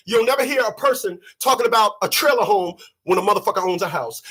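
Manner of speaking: normal talking voice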